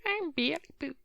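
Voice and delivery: high squeaky cartoon flapper voice; Betty Boop voice. Trying Betty Boop for the hosts, high cartoon squeak. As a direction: squeak it like a thirties cartoon girl. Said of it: "I actually have no idea how that voice goes".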